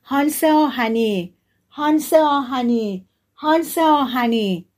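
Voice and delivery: slow, high, loud calls drawn out; loud calling shout. A narrator's reading. Boy summoning the wild man in the forest, slow loud shouts. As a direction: call the name out across the forest. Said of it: "سه بار با صدای بلند فریاد زد".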